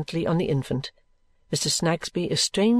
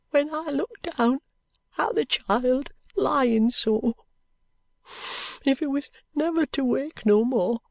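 tearful mother; 10 semitones higher, slower than usual. Acted. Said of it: "her eyes filling with tears".

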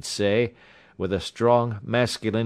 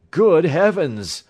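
loud, high, drawn-out exclamation; shocked cry; 9 semitones higher, 9 dB louder, slower than usual. A narrator's reading. Doctor seeing the severed thumb, crying out in shock. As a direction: cry out slowly in horror. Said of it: "I cried".

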